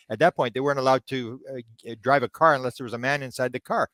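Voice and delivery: ordinary delivery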